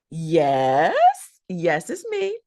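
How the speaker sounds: a hint of a happy kid answering; excited child on phone.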